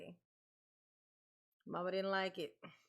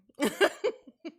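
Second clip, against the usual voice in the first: high burst of laughter; loud laugh; 7 semitones higher, 12 dB louder than usual. Real, not acted. Lacht om het stukje pen.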